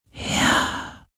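kaum hörbar gehaucht. A narrator's reading. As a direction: hauche das Wort. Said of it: "hauchte der Geist des Meeres". (gehauchtes Ja)

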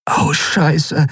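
usual speaking voice

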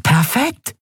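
hoch und begeistert rufen; hohe, helle Figurenstimme voller Freude; freudiger Ausruf. Acted. Nelen freut sich. (freudig hoch)

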